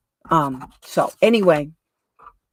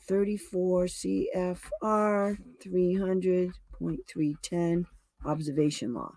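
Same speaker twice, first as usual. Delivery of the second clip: halting, slow and softer; slow self-dictation; 7 dB quieter, slower than usual. Real, not acted. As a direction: spell it out slowly while noting it. Sounding out words while noting them.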